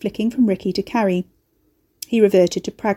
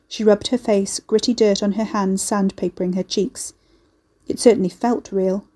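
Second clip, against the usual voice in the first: ordinary delivery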